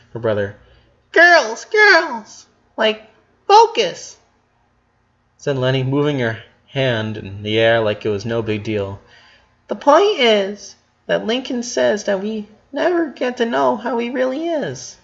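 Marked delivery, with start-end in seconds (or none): 1.1-4.2 much higher, louder call for focus. A narrator's reading. Leni calling her sisters to focus, higher and louder. 9.7-15.0 much higher, hesitant sister; lightly stumbling high girl voice; high girlish voice. A narrator's reading. Leni haltingly explaining to her sisters, higher voice.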